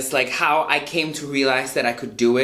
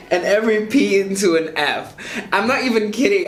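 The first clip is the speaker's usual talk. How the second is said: higher, giggly explanation; laughing; 4 semitones higher than usual. Real, not acted. Vlogger explaining the accent's letter swap, laughing. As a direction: talk through real giggles.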